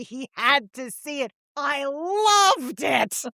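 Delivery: high, drawn-out rave about the show; gushing theatregoer impression. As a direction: stretch every word like a starstruck Long Island matron. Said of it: "a woman who talks like this".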